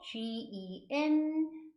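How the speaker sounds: very slow, stretched letters; spelling out. Shown as a demonstration. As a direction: spell G E N slowly, almost sung. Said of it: "이렇게 발음하시면 되고".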